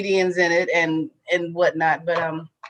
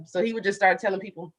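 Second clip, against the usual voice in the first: normal talking voice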